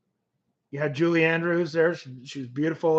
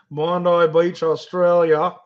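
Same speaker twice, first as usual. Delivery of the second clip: louder repeat of the mock Australian accent; 6 dB louder, slower than usual. Shown as a demonstration. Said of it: "my Australian accent isn't the best".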